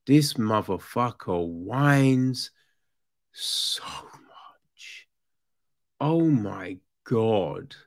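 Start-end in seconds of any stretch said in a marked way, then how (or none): 3.3-5.1 breathy, voiceless emphasis; exasperated whisper. Real, not acted. Reviewer worn down by a whining character, exasperated whisper. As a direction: let it out as a drained whisper.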